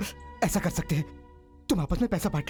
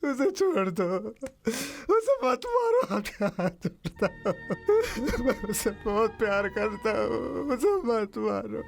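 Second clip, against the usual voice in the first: sobbing plea; 3 semitones higher than usual. Acted. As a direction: beg through heavy tears. Sobbing plea for child's life. (रोते हुए गिड़गिड़ाना)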